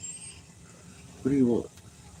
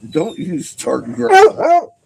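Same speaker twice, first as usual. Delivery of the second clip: slow, much louder and higher; loud high scold; 14 semitones higher, 11 dB louder, slower than usual. Real, not acted. Owner scolding the dog, loud high slow command. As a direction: bark it at the dog slowly.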